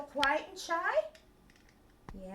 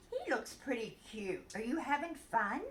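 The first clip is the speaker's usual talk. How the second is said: normal talking voice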